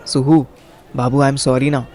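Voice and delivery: ordinary talk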